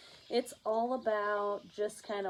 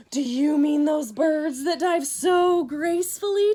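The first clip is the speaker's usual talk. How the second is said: gasp, then ask loud and high; gasp then loud eager question; 7 semitones higher, 10 dB louder than usual. Acted. Tacky gasps and loudly asks the hunters about diving birds.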